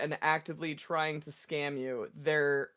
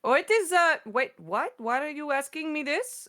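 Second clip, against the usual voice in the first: high, sugary call-center politeness; scam caller voice; 10 semitones higher than usual. Retold or imitated. Retelling the scam caller's sweet reply in a high voice. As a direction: lightly mimic an overly polite scammer. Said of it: "I'm like".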